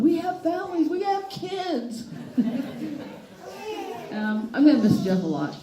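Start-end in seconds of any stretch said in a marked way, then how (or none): 0.0-2.1 high, slow laughing plea; mock pleading yell. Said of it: "I kept telling him". 2.1-4.1 real laugh at the memory; hearty laugh. Real, not acted. Laughing at own story.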